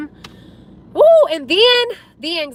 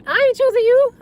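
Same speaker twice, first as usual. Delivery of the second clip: much higher, teasing mock voice; a put-on voice for a playful imagined reply; joking quote; 11 semitones higher, 3 dB louder than usual. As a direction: play him brushing them off, jokingly. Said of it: "I'm just joking".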